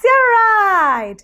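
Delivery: normal talking voice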